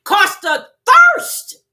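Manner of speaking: thirst drawn out high and loud; slow, loud, anguished preacher cry. Real, not acted. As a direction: wail it, slow and stretched. Preacher cries out in sermon.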